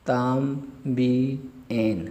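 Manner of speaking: तांबियन stretched very slowly, tam-bi-en; slow model word. Shown as a demonstration. Teacher modelling तांबियन slowly. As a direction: draw out तांबियन syllable by syllable.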